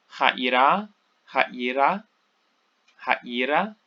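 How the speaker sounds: ha'ira repeated slowly with the stress before the unaccented final -ra; accent demonstrated. Shown as a demonstration. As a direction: say ha'ira as ha-EE-ra, rising at the end. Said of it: "'cause the accent is here".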